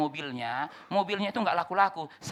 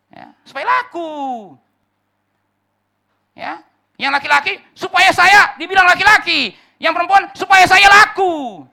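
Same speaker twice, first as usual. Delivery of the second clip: loud, slow, thundering preacher's cry; full-force preaching; 13 semitones higher, 17 dB louder, slower than usual. Acted. Preacher warns the congregation about chasing approval, thundering loud. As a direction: bellow it out from the pulpit, slowly.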